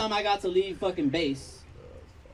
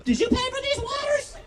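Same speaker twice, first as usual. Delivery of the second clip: mock receipt checker; 6 semitones higher than usual.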